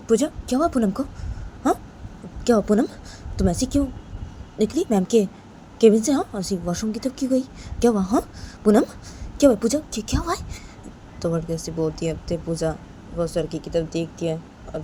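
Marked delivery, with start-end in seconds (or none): 0.1-10.5 put-on concerned boy. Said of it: "वो लड़का बोलता है कि".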